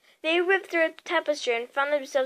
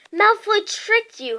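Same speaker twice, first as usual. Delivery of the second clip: sharp, raised accusing voice; scolding; 7 semitones higher, 5 dB louder than usual. Acted. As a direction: call him out, higher and louder. Hermione scolds Harry.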